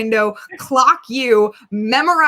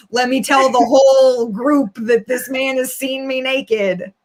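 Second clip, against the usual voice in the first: slow, drawn-out pretend announcement to a crowd; exaggerated, slowed-down imitation of a public accuser; mock public announcement; slower than usual. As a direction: announce it absurdly to the whole group. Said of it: "He's not gonna be in public like".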